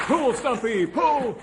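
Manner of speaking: high, loud shout while hauling; straining shout. Acted. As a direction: yell urging them to pull hard. Hauling a man out.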